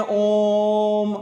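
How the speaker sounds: ओम held out slowly, three times as long; long drawn ओम for the class; prolonged Om. Shown as a demonstration. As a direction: draw the ओम out like a chant. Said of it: "कुछ देरी तक ओम करते रहेंगे".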